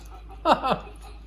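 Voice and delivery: imitating a drunk man laughing at his own joke; high cackle at his own joke, lightly done; drunken laugh. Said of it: "laughing at his own joke".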